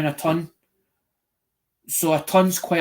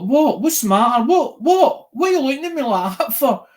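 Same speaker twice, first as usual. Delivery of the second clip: high, loud, defensive squeal of innocence; playing the wrongly accused drinker, pitch climbing; mock indignant "what?"; 8 semitones higher, 3 dB louder than usual. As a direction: act all offended, voice shooting up. Defensive when everyone stares.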